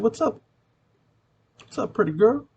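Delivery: high, lilting come-on that lifts at the end; flirty pickup line.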